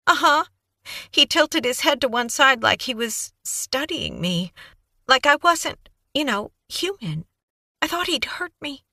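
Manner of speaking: put-on high, breathless young woman babbling on; Kaylee's eager voice. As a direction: gush it out like a nervous, eager witness. Kaylee recounting.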